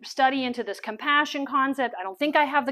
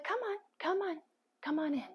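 hushed, higher coaxing; soft beckoning; 4 semitones higher, 11 dB quieter than usual. Retold or imitated. Older missionary woman beckons speaker in, hushed coaxing. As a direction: imitate a lady quietly urging someone closer.

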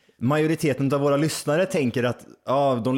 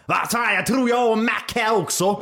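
quoting the neighbour; 7 semitones higher than usual. Retold or imitated. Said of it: "sa han".

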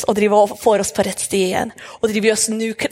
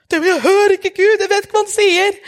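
high, louder whiny doubter voice; voicing a doubter; 9 semitones higher, 3 dB louder than usual. Listener doubting she can hear God, whiny and high. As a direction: whine the objection like a confused listener.

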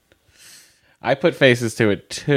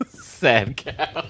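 giggling through the words; laughing; 6 semitones higher than usual. Real, not acted. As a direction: say it while cracking up. Laughing at the cow remark.

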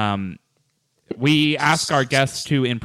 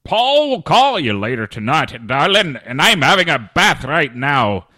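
high, slow, loud hammy Scottish-Texan lilt; comic accent sign-off; Scottish-Texas mix accent; 5 semitones higher, 7 dB louder, slower than usual. Shown as a demonstration. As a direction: read the tagline in a put-on Scottish and Texas blend. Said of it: "as we say at the end".

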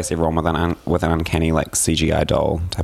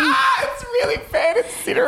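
much higher, laughing squeal; mock reporter. Joining the reporter parody, a laughing high squeal. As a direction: shriek the report through laughter.

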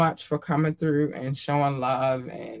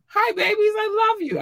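high-pitched cooing at fans; high squeaky greeting; 4 dB louder than usual. Real, not acted.

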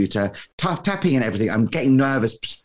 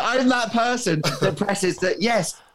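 usual speaking voice

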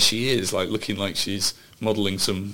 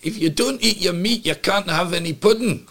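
higher, stern Scottish schoolmaster voice; Scottish teacher accent; 8 semitones higher than usual. Retold or imitated. Host imitates the Pink Floyd teacher to his co-host, Scottish accent. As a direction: bark it like the Scottish teacher from The Wall. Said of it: "second time I've done a Scottish accent".

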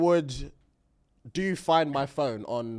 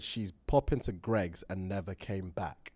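whispered punchline; 7 semitones lower, 9 dB quieter than usual. Real, not acted. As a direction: trail off into a whisper. Trailing punchline.

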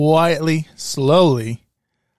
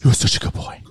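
whispering to a dog; faster than usual. Acted. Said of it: "I'm like".

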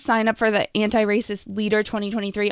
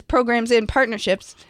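ordinary talk

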